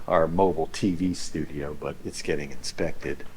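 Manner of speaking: ordinary talk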